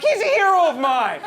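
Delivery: high, loud mock-outraged shout; angry yelling. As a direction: yell it defensively, hammed up. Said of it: "You can't yell at me".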